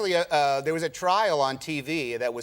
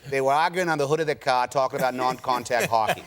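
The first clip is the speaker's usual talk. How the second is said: put-on broad Boston accent, dropped r's; 3 semitones lower than usual. Actor practising his role while walking around, Boston accent. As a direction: rehearse a line in Boston speech. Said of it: "I was walking around saying".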